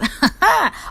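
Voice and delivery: short high-pitched laugh; amused, high burst of laughter. Real, not acted. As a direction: burst out laughing after the mimicry. Laughs at own mimicry.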